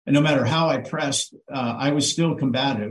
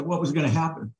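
ordinary delivery

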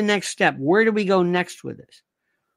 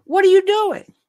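high, loud mock outrage; mock outraged question; 12 semitones higher, 5 dB louder than usual. Comic yelling at Bibi in mock outrage. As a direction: yell it in disbelief.

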